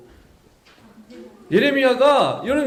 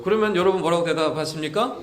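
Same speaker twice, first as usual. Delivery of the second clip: usual speaking voice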